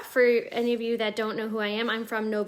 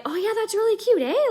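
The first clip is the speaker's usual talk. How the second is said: higher, agreeable tone with a tag "eh?"; validation-seeking eh; 8 semitones higher than usual. Shown as a demonstration. Canadian showing how she uses eh, higher and agreeable. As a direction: lift it high, fishing for agreement. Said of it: "I just more so say".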